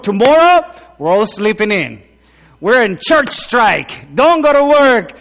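loud, high pulpit preaching, slowing on key lines; preaching at full force. Acted. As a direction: drive each point home to the church. Preaching to the congregation.

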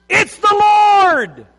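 high, loud and slow, stretched out in wonder; excited cry. Acted. John shouting to Peter from the boat, amazed. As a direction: call it out like you just recognised him. John cries out seeing Jesus.

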